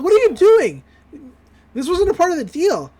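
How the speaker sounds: high, loud, indignant objection; mock outraged protest. Mabel objects to the kidnapping, mock outraged protest.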